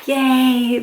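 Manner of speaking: plain reading voice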